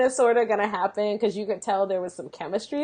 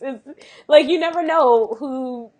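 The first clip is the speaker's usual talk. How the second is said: ordinary delivery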